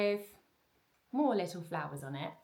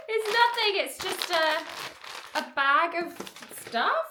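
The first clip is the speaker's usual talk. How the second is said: laughing; 10 semitones higher, 6 dB louder, slower than usual. Real, not acted. Laughs at empty bag.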